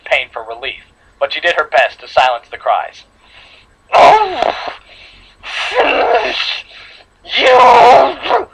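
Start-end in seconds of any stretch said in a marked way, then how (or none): 3.9-8.5 high, choked gasping threat; sputtering villain. Acted. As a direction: spit the words through blood. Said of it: "sputtered out more blood".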